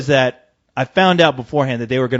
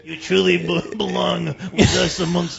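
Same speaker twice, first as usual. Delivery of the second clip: Lando welcoming; 4 dB quieter than usual. Acted.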